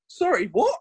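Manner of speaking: high-pitched mock bewilderment; baffled. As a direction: act out a lost viewer interrupting. Acting out a baffled viewer.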